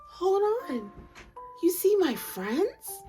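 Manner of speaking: usual speaking voice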